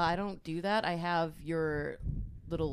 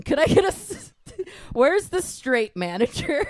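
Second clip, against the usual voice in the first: loud, higher, slow entitled demand; Karen demanding; 7 semitones higher, 7 dB louder than usual. Acted. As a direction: draw it out, indignant. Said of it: "impression of a Karen".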